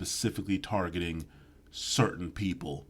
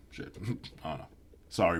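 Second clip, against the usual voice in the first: ordinary talk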